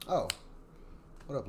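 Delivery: ordinary delivery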